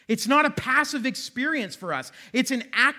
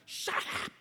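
voiceless, hushed mock snap; whispered shut up; 10 dB quieter than usual. Shown as a demonstration. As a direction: whisper it like a joke. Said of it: "like I do all the time as a parent".

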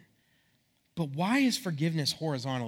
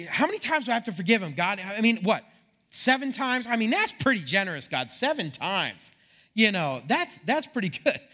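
mock-indignant spouse, pitched up; exasperated bargaining; 6 semitones higher, 4 dB louder than usual. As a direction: protest to God in a high, put-upon voice. Voicing fed-up spouse bargaining with God.

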